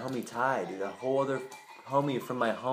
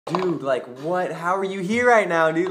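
surprised, a little higher and louder; retold surprise; 5 semitones higher, 5 dB louder than usual. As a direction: disbelief at bumping into an old friend. Surprised to meet friend.

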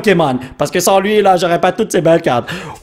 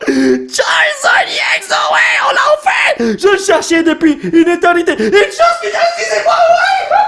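much higher, slow crying shouts; ecstatic shout. Real, not acted. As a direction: scream in real disbelief and joy. Pulls long-sought Charizard. (crier de joie incrédule, beaucoup plus aigu)

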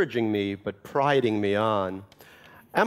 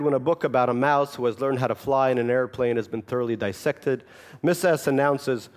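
normal talking voice

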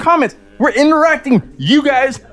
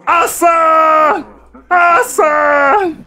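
excited winner call; 4 semitones higher, much slower than usual. Real, not acted. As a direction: call out the winner like a ring announcer. Announcing a fight winner.

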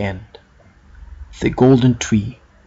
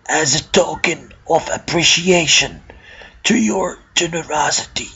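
put-on a gentle old man offering a gift; grateful old man voice; 3 semitones higher than usual. Old man figure thanks Sam.

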